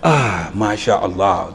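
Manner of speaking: slow, hushed mashallah; soft admiring sigh. Retold or imitated. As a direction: draw it out softly in admiration. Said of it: "Be like".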